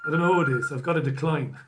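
ordinary talk, nothing marked

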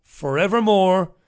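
normal talking voice

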